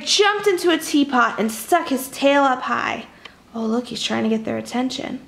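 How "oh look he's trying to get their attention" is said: usual speaking voice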